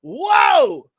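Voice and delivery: high, loud shouted whoa; outraged shout. Retold or imitated. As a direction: blast the whoa at her, outraged. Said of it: "and I go".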